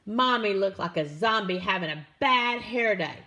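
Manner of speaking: plain reading voice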